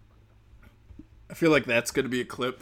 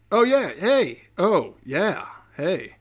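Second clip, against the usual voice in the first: excited cheer; 5 semitones higher, 6 dB louder, much slower than usual. Pretend. Sings a jingle-like cheer.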